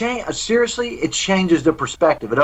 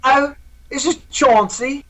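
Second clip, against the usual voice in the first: slow, high, hammed-up British accent; a posh British gent introducing himself, in a British accent; 9 semitones higher, slower than usual. Actor asked for lines as Cameron, does British accent. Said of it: "give us a couple lines here".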